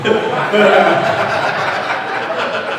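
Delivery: loud unvoiced burst of laughter; real loud laugh; hearty laugh. Real, not acted. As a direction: crack up at your own joke. Continued laughter.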